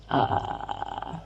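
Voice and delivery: slow, low creak; slow creaky fry. Shown as a demonstration. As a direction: drag it out in fry. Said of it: "try a little vocal fry or creaks".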